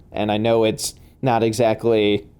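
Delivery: ordinary talk, nothing marked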